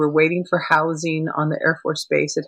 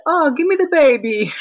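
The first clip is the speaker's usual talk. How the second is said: imitating Italian neighbor; 11 semitones higher, 5 dB louder than usual. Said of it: "with a really strong Italian accent".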